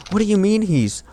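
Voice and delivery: high, shocked demand; shocked shout. Acted.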